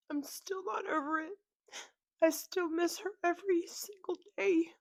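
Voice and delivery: high, choked-up sobbing voice; crying. Real, not acted. Daughter still missing her mom years later, crying. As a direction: cry through it, pitch climbing. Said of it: "sorry for crying like this".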